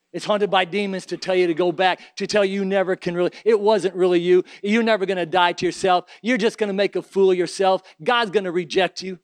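fiery preaching. Acted. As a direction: preach it hard and loud. Preaching at full force.

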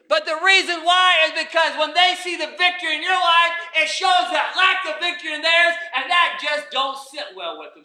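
emphatic preaching, surging on key phrases; forceful preaching. Real, not acted. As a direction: drive the point home to the congregation.

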